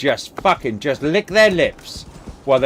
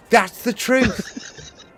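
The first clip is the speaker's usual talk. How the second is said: usual speaking voice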